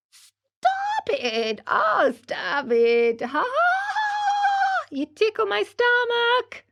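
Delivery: very high, squealing through giggles; a ticklish little voice laughing; giggling tiny voice. A narrator's reading. Tickled wood's tiny voice giggles under the plane, very high. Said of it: "it giggled as it spoke".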